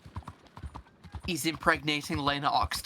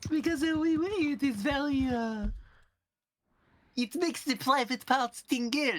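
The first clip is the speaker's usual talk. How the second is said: put-on French accent; 8 semitones higher than usual. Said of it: "You're allowed to like the French accent".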